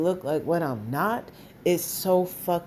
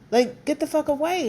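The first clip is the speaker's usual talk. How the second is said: higher, sharp dismissal; exasperated outburst; 8 semitones higher than usual. Real, not acted. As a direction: pitch jumps up high in anger. Telling critics to leave.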